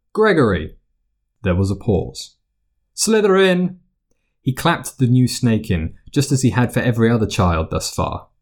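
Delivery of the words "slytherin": high, ringing announcement of the house; Sorting Hat call. A narrator's reading. The hat calling out the new student's house.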